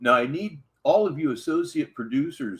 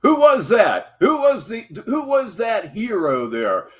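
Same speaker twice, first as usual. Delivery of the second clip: loud surprise; 7 semitones higher, 5 dB louder than usual. Real, not acted. Reacting to a caller's voice.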